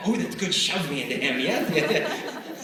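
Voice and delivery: giggly, eager yes yes through laughter; laughing mock girl. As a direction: gush like a thrilled schoolgirl. Girl delighted by shove plan.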